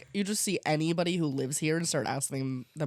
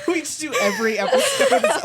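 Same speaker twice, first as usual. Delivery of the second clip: laughing; 5 dB louder than usual. Real, not acted. Joking through laughter.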